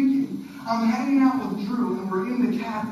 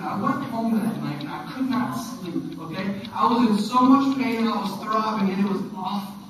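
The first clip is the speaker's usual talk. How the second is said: ordinary talk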